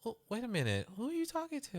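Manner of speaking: very high indignant question; mock indignant parent. Mother scolding her son's tone, very high. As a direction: pitch way up, mock offended. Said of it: "I'm like".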